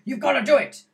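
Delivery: a retold furious command; angry order. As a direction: bark the order like a captain in crisis. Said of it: "And he's really angry".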